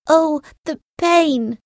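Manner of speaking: high, loud, drawn-out cry of agony; pained cry. A narrator's reading. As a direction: cry out slowly as pain strikes. Captain struck by magic, in pain.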